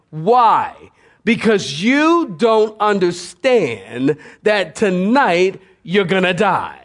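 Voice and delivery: usual speaking voice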